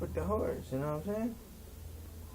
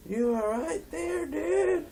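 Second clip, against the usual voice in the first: high, drawn-out mock disbelief; slow it down and pitch it way up; incredulous protest; slower than usual. Incredulous correction of friend, acted.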